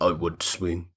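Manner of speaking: low, soft simple-minded voice; low caveman voice. Retold or imitated. Voicing Tarzan.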